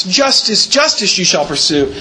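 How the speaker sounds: "Justice, justice" climbing in pitch, pursue falling away; stress on JUSTICE. Shown as a demonstration. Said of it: "when they move it first and repeat it twice".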